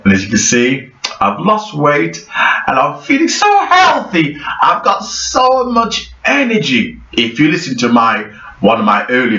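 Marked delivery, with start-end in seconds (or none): 2.7-7.0 bubbly boast. Real, not acted. Showing off weight loss.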